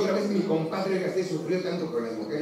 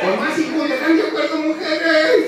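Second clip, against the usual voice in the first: much higher and louder; shouted rally; 9 semitones higher, 8 dB louder than usual. Real, not acted. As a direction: fire the crowd up. Rallying the crowd.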